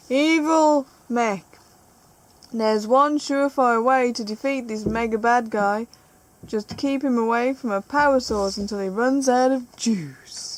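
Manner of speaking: loud announcer voice, name drawn out; hammed-up trailer-style reading; dramatic card read. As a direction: announce the villain with relish.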